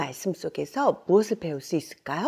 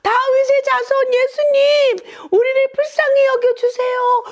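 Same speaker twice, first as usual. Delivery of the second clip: very high, loud desperate plea; even louder shouting; 7 dB louder than usual. Acted. Blind men defying the crowd to beg Jesus, full high shout. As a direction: cry out at the very top of your range. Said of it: "더욱 큰 소리로 예수님께 외쳤어요".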